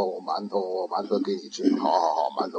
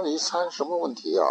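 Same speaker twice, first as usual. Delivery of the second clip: curious master; 7 semitones higher, 3 dB quieter than usual. Acted. Master asks novice.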